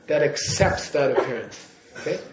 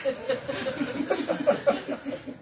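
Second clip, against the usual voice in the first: high laugh; 9 semitones higher, 8 dB quieter than usual. Real, not acted. Laughs after warning students.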